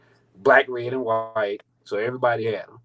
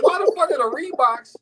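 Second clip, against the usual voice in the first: high, laughing outrage at the wrong shoes; mock-outraged high voice; disbelief; 10 semitones higher than usual. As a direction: go high and incredulous, half laughing. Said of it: "I'm like".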